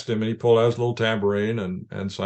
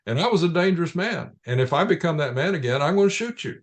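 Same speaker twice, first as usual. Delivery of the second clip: higher-pitched, emphatic mock threat; lightly acted threat, pitched up; retold warning; 8 semitones higher than usual. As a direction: quote the warning with rising pitch. Retold threat to those pressuring him.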